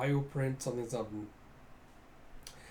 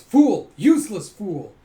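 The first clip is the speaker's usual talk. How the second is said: explosive high-pitched yell of contempt; enraged villain berating a minion; furious shout; 6 semitones higher, 16 dB louder, slower than usual. Acted. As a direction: roar at a bungling underling. Master stomps on servant in rage.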